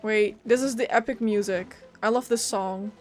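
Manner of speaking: ordinary talk, nothing marked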